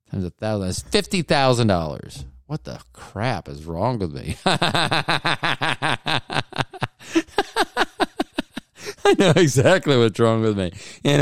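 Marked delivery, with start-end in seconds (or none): 4.4-6.9 long rapid giggling fit; helpless stoned giggle in quick bursts; laughing. Real, not acted. Host cracking up at his own muddled math, giggling.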